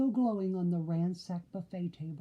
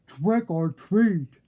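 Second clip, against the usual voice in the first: deep shout; 9 dB louder, much slower than usual. A narrator's reading. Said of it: "a deep voice shouted".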